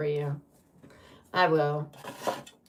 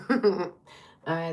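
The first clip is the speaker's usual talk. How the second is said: ordinary talk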